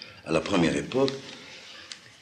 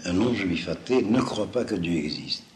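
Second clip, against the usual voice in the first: ordinary delivery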